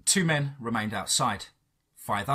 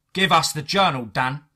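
raised, forceful demand; restrained scream, more loud order than shriek; agent screaming; 3 semitones higher, 6 dB louder than usual. A narrator's reading. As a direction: bark the order loudly. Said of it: "the agent screamed again".

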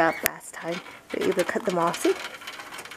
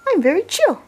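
bunny voice; 9 semitones higher, 3 dB louder than usual. Pretend. Voicing the bunny's thoughts.

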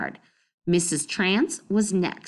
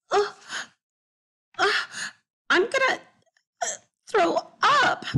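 much higher, hammed-up gagging cry; loud fake retching; 14 semitones higher than usual. Ivy faking sickness to scare the neighbour, loud mock retching. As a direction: pretend to be about to throw up, loudly. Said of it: "Ivy said loudly".

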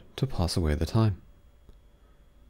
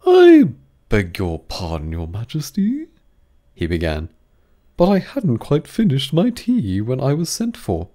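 loud, high apologetic hatter voice; the Hatter excusing himself to the king; Hatter's excuse; 5 semitones higher, 6 dB louder than usual. A narrator's reading.

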